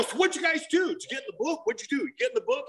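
high-pitched, eager questioning; eager questions. Retold or imitated. Eager newcomer quizzing his sponsor, high and excited. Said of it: "I go".

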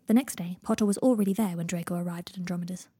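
ordinary delivery

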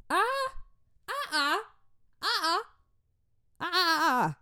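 very high, repeated "uh-uh"; mock flustered squeals. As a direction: squeak out short comic refusals. Comic flustered noises after repeated why.

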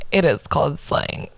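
ordinary talk, nothing marked

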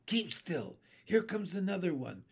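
ordinary delivery

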